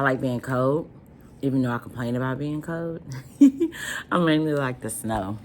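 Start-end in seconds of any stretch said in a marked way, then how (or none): 3.1-4.1 a short high burst of laughter; real laugh. Real, not acted. Vlogger laughing at her own complaint about cold, high laugh. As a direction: laugh at yourself, high and loud.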